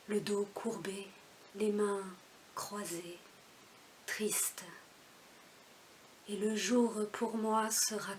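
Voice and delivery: measured recitation with long pauses; poem recital. Acted. (récitation posée d'un poème)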